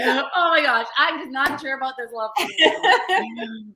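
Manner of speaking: put-on laughing through a joke about a rich old guy; giggling mock plan, laughter breaking in. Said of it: "me and my sister joked about that".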